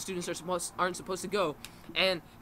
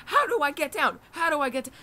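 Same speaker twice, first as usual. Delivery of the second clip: high, louder mock panic; imitating freaking out; 8 semitones higher, 4 dB louder than usual. Girl stuck up on the ladder, imitated freaking out. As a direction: act out a panicked plea, pitched up. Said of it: "like, freaking out".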